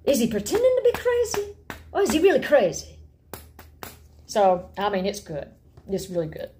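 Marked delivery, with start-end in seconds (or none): 0.0-3.0 high, theatrical rising questions; dramatic Hamlet questioning. Retold or imitated. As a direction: act out Hamlet's doubt. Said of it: "he really nails the whole thing of".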